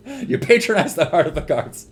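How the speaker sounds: high, loud mock scolding broken by laughs; gleeful loud ribbing, laughing between phrases; laughing taunt. Real, not acted. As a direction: taunt your friend while cracking up.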